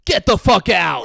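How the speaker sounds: high, loud shout throwing him out; furious shout. Acted. Host yelling at his cohost in a staged bit, loud shout. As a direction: roar it like a mock fury.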